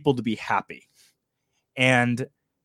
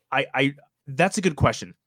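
ordinary talk, nothing marked

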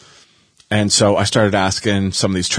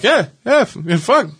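strained high voice; 9 semitones higher than usual. Retold or imitated. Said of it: "And I'm like".

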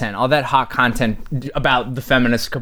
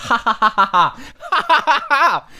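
sarcastic fake laugh; 11 semitones higher than usual.

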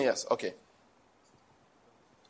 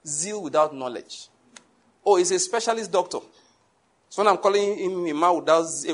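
ordinary talk

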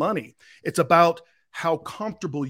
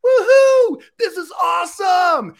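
much higher, loud mock crowd cheer; 6 dB louder than usual. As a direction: whoop like an excited crowd. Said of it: "Everybody's like".

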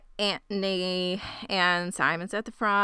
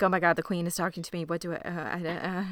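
fast, softer, stammering panic; mock flustered; 2 semitones lower, 4 dB quieter, faster than usual. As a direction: babble as if starstruck. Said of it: "she's like".

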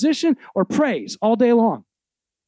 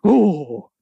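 mock awed oh. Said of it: "remember, uh, Lion King?".

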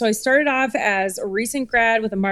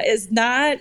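usual speaking voice

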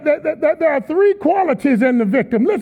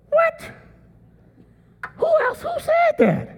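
squeaky falsetto disbelief, way up high; high mock-startled squeal; mock astonishment. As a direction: feign shock in a squeaky high voice.

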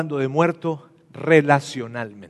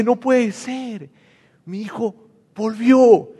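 slow, awed disbelief; stunned father; 7 semitones higher, much slower than usual. Acted. Father seeing his lost son return, slow and amazed. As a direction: let the words hang in wonder. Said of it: "Su papá dice".

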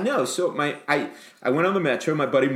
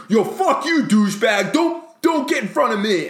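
high, loud road-rage yell; furious scream; 10 semitones higher, 5 dB louder than usual. Retold or imitated. Yelling at a driver who pulled ahead, furious re-enacted scream. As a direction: scream abuse at the car ahead, pitch way up. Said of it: "I would scream".

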